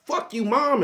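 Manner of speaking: mock brat talking back to mom; bratty kid voice. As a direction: sneer it like a spoiled brat. Mocking kids cursing at parent.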